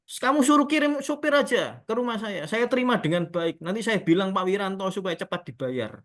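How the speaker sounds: high, loud start easing down; changed, obliging tone. Retold or imitated. Haji promising quick payment to supposed champion, imitated eager insistence. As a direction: imitate an eager client suddenly accommodating. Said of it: "Dia kok ngomongnya seperti ini".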